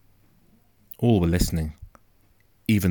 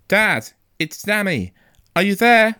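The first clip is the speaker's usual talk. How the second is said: calling out; 14 semitones higher, 7 dB louder than usual. A narrator's reading. Boy searching for his missing father, calling out loudly. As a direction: call far into the wood. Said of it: "walking and calling out".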